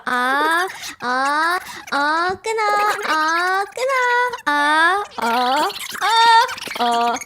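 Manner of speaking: drawn-out rising ah, ah in a put-on voice; mimicking a slow Korean phone goodbye. Imitating him dragging out a phone hang-up, mock drawn-out. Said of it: "And he goes".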